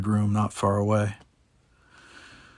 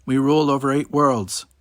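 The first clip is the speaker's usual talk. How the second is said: loud, raised accusation; thundering king; 4 semitones higher, 6 dB louder than usual. A narrator's reading. King accuses the ambassador of lying, thundering anger. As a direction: hurl the accusation at him. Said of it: "the king's voice thundered out".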